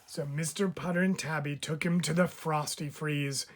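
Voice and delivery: ordinary delivery, nothing marked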